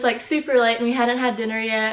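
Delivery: usual speaking voice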